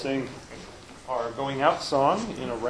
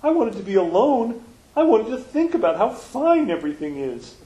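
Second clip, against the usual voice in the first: much higher and louder frog voice; happy Frog voice; 8 semitones higher, 4 dB louder than usual. Acted. Frog reassuring Toad on the island, high happy voice. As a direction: Frog reassuring his friend. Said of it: "said Frog".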